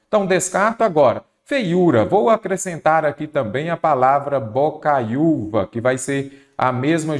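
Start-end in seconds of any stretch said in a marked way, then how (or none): none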